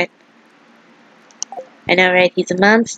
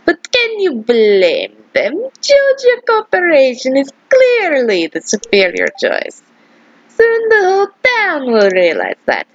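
Morris/character. Acted. Voices gloating Morris to shopkeeper.

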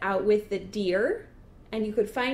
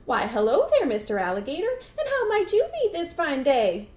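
overly polite, swooping greeting; loud, lilting mock courtesy; fast-talking dog; 6 semitones higher, 5 dB louder than usual. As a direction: sweet-talk your way out of trouble. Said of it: "she did some fast talking".

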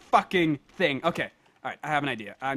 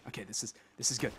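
ordinary delivery

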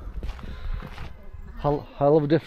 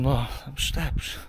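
ordinary talk

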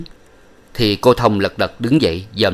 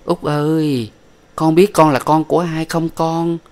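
put-on higher, tender mother cooing at her little boy; cooing to her child; 7 semitones higher than usual. A narrator's reading. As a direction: coo it like a mother cradling her son. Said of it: "nựng nịu mà rằng".

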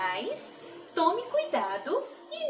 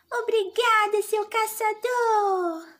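put-on high, sweet child's thanks; grateful little girl; 8 semitones higher than usual. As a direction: thank him like a small girl.

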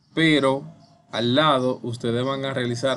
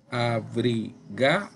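"abrigado" slowly in separated syllables, left questioning; spaced-out "abrigado", inviting an answer; syllable-by-syllable demo; slower than usual. Shown as a demonstration. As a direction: sound out "abrigado" piece by piece, rising as a prompt. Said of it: "Uno, dos".

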